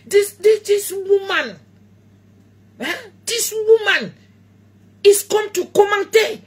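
mock-scold the commenter in broken English; a hint of a teasing telling-off in French-accented English.

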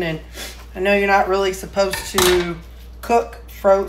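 ordinary talk

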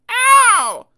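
sharp squeaky ow; high pained yelp. Acted. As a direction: yelp like a hit toy. Hit by rackets.

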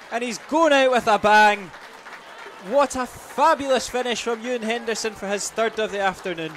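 normal talking voice

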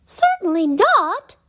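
high, sharp princess refusal; cross snap. A narrator's reading. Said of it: "snapped Poppy crossly".

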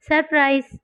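bright, high, cheerful shout of surprise with a laugh in it. A narrator's reading. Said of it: "என்றான் இன்பரசன் சிரித்துக்கொண்டே".

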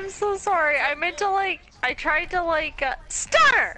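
tipsy, flustered, then calling out; flustered apology. Real, not acted. Apologising, calls friend.

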